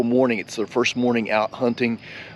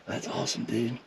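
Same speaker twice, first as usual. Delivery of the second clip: soft whispered praise; hushed, mostly voiceless; whispering; 9 dB quieter than usual. Real, not acted. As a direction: whisper congratulations to your buddy. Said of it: "we're whispering now".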